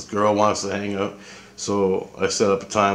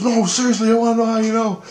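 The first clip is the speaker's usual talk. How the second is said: mock pleading girlfriend; 13 semitones higher than usual. Girlfriend insists.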